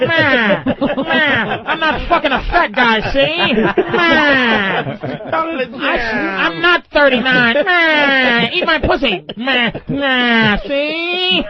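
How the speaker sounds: hammed-up old movie gangster whine; mock a whiny woman as a film gangster, stretching the cries; Edward G. Robinson. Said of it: "Edward G. Robinson voice with titties".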